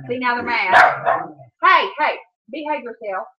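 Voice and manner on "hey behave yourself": ordinary talk, nothing marked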